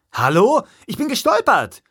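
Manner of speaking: loud, high-pitched outrage; high, exasperated self-defence; indignant protest. A narrator's reading. Hero protests louder.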